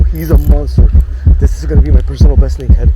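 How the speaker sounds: roaring with thrill, fast; excited shouting. Real, not acted. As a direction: bellow it out like a win. Fighting a big fish.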